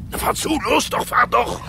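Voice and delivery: hoch und laut antreiben; aufgeregtes Rufen. Acted. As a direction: hektisch zum Losfahren drängen. Aufregung bei der Verfolgung. (laut und hoch gerufen)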